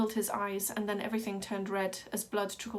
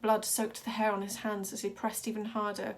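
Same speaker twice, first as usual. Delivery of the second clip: ordinary delivery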